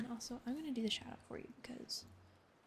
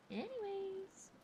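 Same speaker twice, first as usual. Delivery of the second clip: drawn-out anyways; 9 semitones higher, much slower than usual. Real, not acted. Drawn out topic change.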